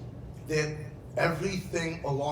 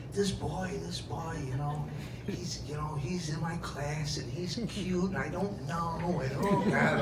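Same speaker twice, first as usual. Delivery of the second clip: gossipy chatter; 7 dB quieter than usual. Retold or imitated. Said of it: "they're chattering".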